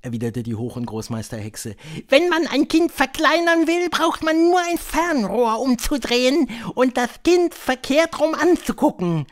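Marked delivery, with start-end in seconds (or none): 2.1-9.3 high, loud witch voice; high-pitched, theatrical witch leader; Grand High Witch. A narrator's reading. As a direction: lecture the coven in a high, imperious voice.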